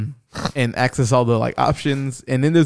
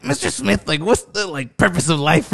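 higher put-on voice, breaking into amusement; imitated voice effect; 4 semitones higher than usual. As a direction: mimic a voice-changer while asking earnestly. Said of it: "this effect on his voice".

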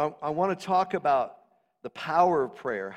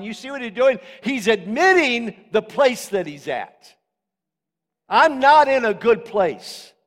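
raised, loud preacher's delivery; impassioned preaching; 9 semitones higher, 10 dB louder than usual. Acted. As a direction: drive each phrase home forcefully to the congregation. Preaching at full force.

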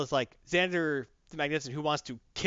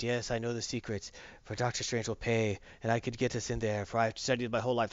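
lower, softer unhinged muttering wizard; crazed wizard voice; 5 semitones lower, 6 dB quieter than usual. Retold or imitated. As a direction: play a crazed old wizard, low and hushed. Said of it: "who was all crazy and talking to himself".

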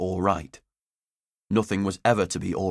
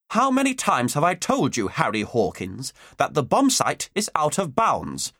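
stern teacher; 6 semitones higher than usual. Acted. Headmistress scolding a boy caught out of bounds, stern. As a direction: lay down the law, word by word.

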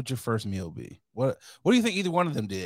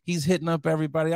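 ordinary delivery

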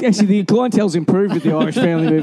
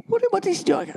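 high, hushed mock-puzzled wife; imitating wife; 11 semitones higher, 6 dB quieter than usual. Host voices wife watching him eavesdrop, high and hushed. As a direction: lift it high and soft, as the baffled wife. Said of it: "is looking at me going".

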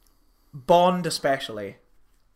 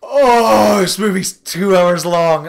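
exasperated; 4 semitones higher, 12 dB louder, much slower than usual. Retold or imitated. Exasperated at slow old film.

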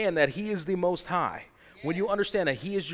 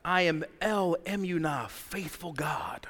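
hushed reverent; slower than usual. Real, not acted. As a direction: drop to a slow whisper on Faithful God. After shout, hushed name.

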